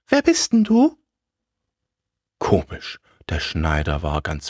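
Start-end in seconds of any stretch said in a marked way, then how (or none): 0.1-1.0 very high-pitched character voice; unknown voice. A narrator's reading. Scarecrow asks the tailor who he is, very high. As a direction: ask in a squeaky-high put-on voice. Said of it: "sagte die Stimme".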